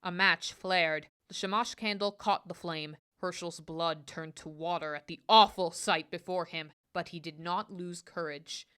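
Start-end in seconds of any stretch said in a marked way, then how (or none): none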